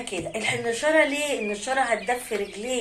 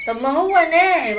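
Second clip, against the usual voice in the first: higher, louder protest as a viewer; viewer's objection; 4 semitones higher, 7 dB louder than usual. Acted. Viewer argues the feed is already fine, protesting. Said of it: "اقول لك لا".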